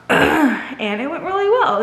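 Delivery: slow, laughing and relieved; laughing, pleased. Real, not acted. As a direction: let a laugh carry the words. Happy report, laughing.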